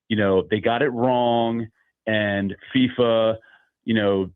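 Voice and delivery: ordinary delivery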